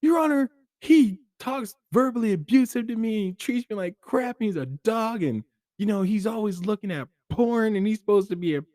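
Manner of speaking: high, loud, whiny accusation; angry spouse in court. Acted. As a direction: complain bitterly to the judge. Divorce court complaint acted.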